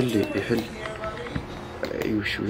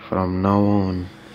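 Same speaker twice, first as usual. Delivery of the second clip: slow dramatic line; 5 dB louder, much slower than usual. Acted.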